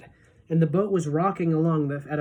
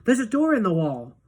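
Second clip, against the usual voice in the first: put-on higher, louder boyish call; excited cry; 4 semitones higher, 4 dB louder than usual. A narrator's reading. Charlie tells Grandpa about the door, excited. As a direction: point it out with eager delight. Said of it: "cried Charlie".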